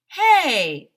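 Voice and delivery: loud, high hey; calling out. Acted. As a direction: call the group over. Neil calls out to friends.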